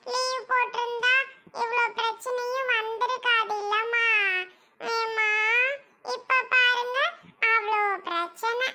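put-on high, plaintive child's voice; upset little child. Child regretting going to school to mother, sorrowful. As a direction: lament it like a guilty child.

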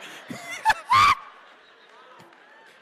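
high shouted laugh; roaring laugh; loud burst of laughter. Real, not acted. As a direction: crack up loudly at yourself. Cracks himself up.

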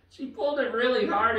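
ordinary talk

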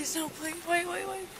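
high desperate pleading; anguished denial. Real, not acted. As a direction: beg him to wait, voice rising. Pleading during a sobriety stop.

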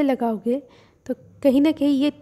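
speaking through fingers; muffled behind hand; hand over mouth. Real, not acted. Seen 0: Hand presses sheet mask over mouth from 0.9 s.

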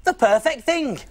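ordinary talk, nothing marked

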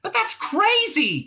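very high, drawn-out protest; crying out. A narrator's reading. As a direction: cry out in disbelief. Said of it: "cried Trillian".

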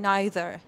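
NEITHER with an "eye" in the first syllable; British pronunciation. Shown as a demonstration. Teacher reminding learners how Brits differ, "neither" as NIGH-ther.

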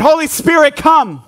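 mean every word, call it out. Shown as a demonstration. Pastor leads the church in calling out, loud and earnest. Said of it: "Say it like you mean it".

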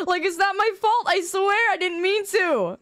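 playful whiny self-defence; mock-defensive protest. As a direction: protest high, stretching the end. Protests her innocence to chat.

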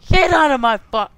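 loud furious yell, cut off; angry yell. Real, not acted. Gamer yelling at an inmate, loud and angry. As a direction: yell at him to get out.

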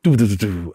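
low mouth-drum doof doof doof; low, beatboxed drum thumps; beatboxed kick drum. Retold or imitated. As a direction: imitate the beat with your mouth. Imitating the beat.

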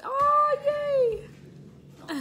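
high, loud, drawn-out squeal of delight; excited cheer. Acted. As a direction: let out a big high-pitched cheer. Cheering at being hooked.

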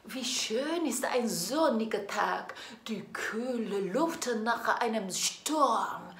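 ordinary talk

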